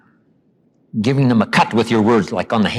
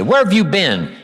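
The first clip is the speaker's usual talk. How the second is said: higher, pointed sarcastic question; loaded sarcastic jab; 7 semitones higher than usual. Retold or imitated. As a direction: call out the latecomer in front of everyone. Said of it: "Someone walks in late and you say".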